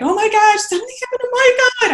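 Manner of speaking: very high, loud reenacted cry of alarm; urgent high-pitched retold scream; panicked scream. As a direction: relive the panic at a piercing pitch. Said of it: "And so I start screaming".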